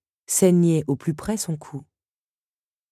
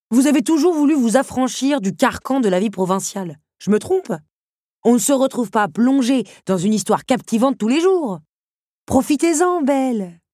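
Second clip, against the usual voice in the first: ton engageant; 8 semitones higher, 5 dB louder than usual. A narrator's reading. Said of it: "Un sourire engageant aux lèvres".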